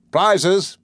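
cried out. A narrator's reading. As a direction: announce the prizes to the crowd. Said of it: "he cried".

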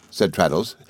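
plain reading voice

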